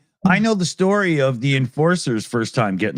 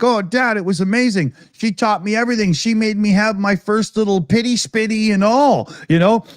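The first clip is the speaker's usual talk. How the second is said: put-on higher, giddy boy voice; excited son; 8 semitones higher than usual. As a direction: gush to Dad like a thrilled teenager. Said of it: "he's like".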